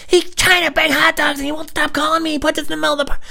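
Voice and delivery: very high, loud frantic voice; frantic boss. Retold or imitated. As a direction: shriek out a panicked excuse. Boss shrieks excuse.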